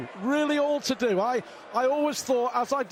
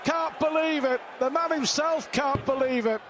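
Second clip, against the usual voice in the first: excited commentary; 6 semitones higher than usual. Real, not acted. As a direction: call it with rising excitement. Excited commentator on missed double.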